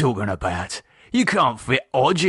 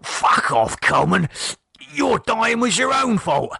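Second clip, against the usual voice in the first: a touch of angry sniping, higher; bickering dead mates; 7 semitones higher than usual. Acted. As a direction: snipe back and forth. Mark and Keith quarrel.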